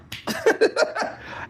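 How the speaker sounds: very high-pitched burst of laughter. Real, not acted. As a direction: burst into a squealing laugh after teasing. Laughs after teasing shut up.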